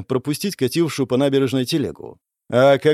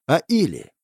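plain reading voice

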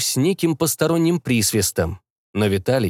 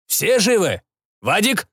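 calling out; 8 semitones higher than usual. Acted. Commander calls out after crash.

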